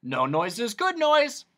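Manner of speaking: humbug's jolly cry; happy exclaim. Acted. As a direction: join in cheerfully. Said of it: "exclaimed the humbug".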